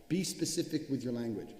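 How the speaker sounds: ordinary talk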